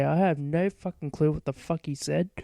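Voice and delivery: normal talking voice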